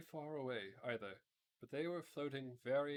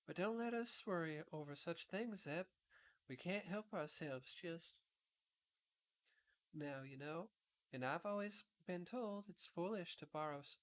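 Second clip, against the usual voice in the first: put-on higher girl's voice, kind and sensible; Dorothy comforting; 6 semitones higher than usual. A narrator's reading. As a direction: soothe the worried boy, lightly. Dorothy comforting Zeb in the story.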